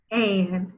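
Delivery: long F sound, pause, then "an" for "And"; word in parts. Shown as a demonstration. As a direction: sound out the onset, then the rime written "And". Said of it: "say a word in parts".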